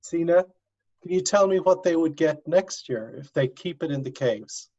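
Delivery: ordinary delivery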